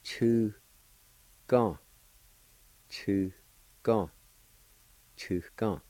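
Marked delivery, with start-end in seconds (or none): 0.0-1.8 Chu held, long gap, then gong; syllable by syllable. Shown as a demonstration. Teacher showing learners the word for flea, syllable by syllable. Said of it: "it's pronounced like this". 2.9-4.1 "Chu", a pause, then "gong"; syllable by syllable. Shown as a demonstration. Teacher repeating the word for flea, syllable by syllable. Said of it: "it's pronounced like this".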